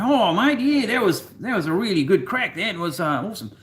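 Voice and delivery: high, loud, cheerful Aussie larrikin voice; Australian accent. Retold or imitated. Husband retells mocking Aussie on Swiss train, broad Australian accent. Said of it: "I swing into this Australian accent".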